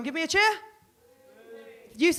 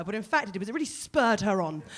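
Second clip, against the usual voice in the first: ordinary talk, nothing marked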